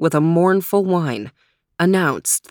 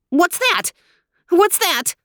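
yelping very high and sharp; startled little dog yelp; high-pitched yelp; 12 semitones higher than usual. Acted. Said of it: "with a high-pitched yelp".

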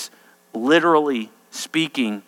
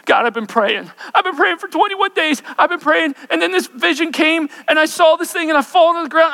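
much higher, pleading prayer voice; desperate Daniel; 11 semitones higher than usual. Acted. Daniel pleads with God after long prayer, high and urgent. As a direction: cry out like a worn-out man praying.

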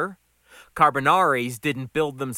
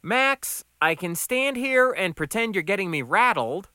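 higher-pitched, amused comeback; unrattled and amused; 7 semitones higher than usual. Acted. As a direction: tease the boss back, voice lifted.

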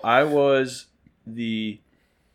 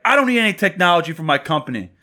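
higher-pitched, insistent customer; mock raised voice; 7 semitones higher, 4 dB louder than usual. Customer rebuffing a sales rep, mock raised voice. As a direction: push back loudly, imitating an irate customer. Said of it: "I was just matching his voice".

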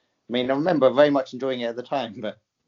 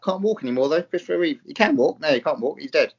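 ordinary talk

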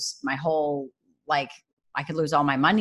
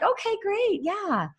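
much higher, bright cheering on; high, light encouraging voice of the Lord; cheerful encourager; 9 semitones higher than usual. Retold or imitated. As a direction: play a loving father cheering on a small child. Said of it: "it's just like".

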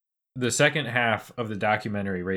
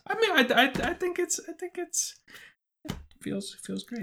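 emotional crying voice; 4 dB quieter than usual. Real, not acted. Overwhelmed by hype.

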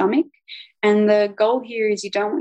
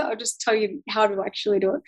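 ordinary talk

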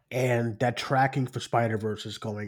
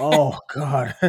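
breaking up laughing; can't stop laughing; laughing hard; 4 semitones higher, 5 dB louder than usual. Real, not acted. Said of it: "let me collect myself here".

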